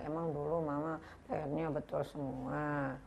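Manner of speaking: quiet, slow sulky muttering; child thinking back at mom, softly and slowly; child's inner voice. Pretend. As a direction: grumble it under your breath like a resentful kid. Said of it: "Dalam hati anak".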